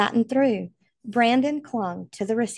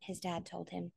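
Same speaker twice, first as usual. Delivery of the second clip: ordinary delivery, nothing marked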